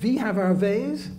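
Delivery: slow, hammed-up German accent; German Nazi accent. Lighthouse lecturer jokes about having ways, in a mock German accent. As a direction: attempt a menacing stage-Nazi German accent. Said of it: "that was my German, uh, Nazi accent".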